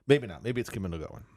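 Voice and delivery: normal talking voice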